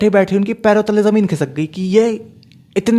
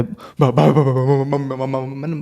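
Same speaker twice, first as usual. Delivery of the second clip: nervous stammer; 4 semitones lower, 3 dB quieter, much slower than usual. Retold or imitated. Caught lying, stammering before answering.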